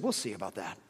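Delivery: low, quiet sceptical retort; doubtful wife, quietly dropped; sceptical reply. Retold or imitated. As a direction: say it low and under the breath, unconvinced. Wife's sceptical reply, first take.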